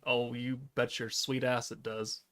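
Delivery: normal talking voice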